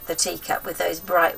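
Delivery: ordinary delivery, nothing marked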